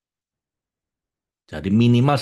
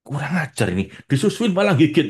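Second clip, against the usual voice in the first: mock angry mother; 6 semitones higher than usual. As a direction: play a mother snapping at her baby.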